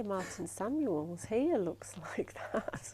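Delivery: hushed, half-laughing near-whisper; amused aside. Real, not acted. Presenter teasing a colleague about matching the description, amused quiet aside. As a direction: slip it in softly, trying not to laugh.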